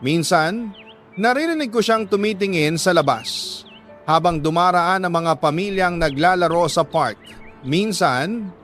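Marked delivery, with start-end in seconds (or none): none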